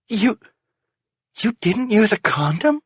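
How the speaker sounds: high, stunned question; aghast. A narrator's reading. Wife realizes husband didn't use protection, aghast. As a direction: ask in disbelieving horror.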